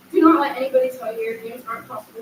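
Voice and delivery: normal talking voice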